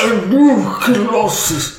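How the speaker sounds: high, slow, drawn-out wizard voice; Gandalf. Acted. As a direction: declaim it grandly like a wizard. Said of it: "the Gandalf voice".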